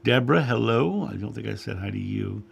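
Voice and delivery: usual speaking voice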